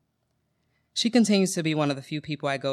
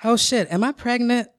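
mock panic; 8 semitones higher, 5 dB louder than usual. Mock panic after stopped periods.

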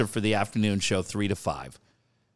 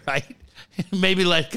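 ordinary talk, nothing marked

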